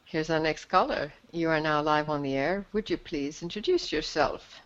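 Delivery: call-in host inviting the next caller to speak, announcer style; radio host announce. Acted. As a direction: introduce the next caller to the line.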